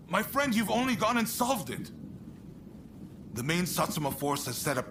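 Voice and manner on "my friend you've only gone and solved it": bright, excited burst of praise; delighted. Acted. Praising a friend who solved the problem, excited. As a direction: cheer your friend on for cracking it.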